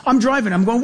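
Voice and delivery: ordinary talk, nothing marked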